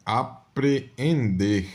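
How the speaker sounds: Apreender said very slowly, each syllable drawn out. Shown as a demonstration. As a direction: stretch out Apreender so the extra e is heard. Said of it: "aqui é aprender e aqui é apreender".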